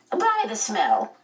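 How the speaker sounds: mildly creaky visitor; voice like an unoiled gate. A narrator's reading. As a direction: draw it out with a slight creak. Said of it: "A voice like an unoiled gate".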